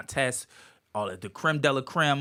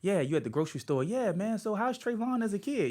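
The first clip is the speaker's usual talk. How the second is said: mock investigator; 10 semitones higher than usual. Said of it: "they're like".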